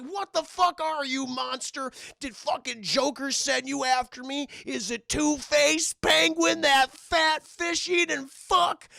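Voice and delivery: high, loud raging tirade; furious shout. Retold or imitated. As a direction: scream insults while beating. Maxie beating Clayface.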